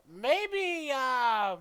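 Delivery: high, loud, testing the price; sly shopkeeper. Acted. Shopkeeper slyly naming a price to customer, high-pitched. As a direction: float the offer high, with pauses to calculate. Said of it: "a little bit of slyness come over her".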